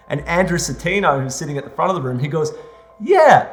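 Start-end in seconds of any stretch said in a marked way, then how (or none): 3.0-3.5 loud, high, enthusiastic backing; imitated excited shout of agreement; rallying cheer. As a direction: call out support to the whole room. Actor rallies the room.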